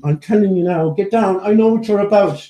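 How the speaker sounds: playfully scold, putting on indignation; feigned indignation. Pretend. Owner telling the dog he is onto him, mock-indignant. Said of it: "it's sort of feigned indignation".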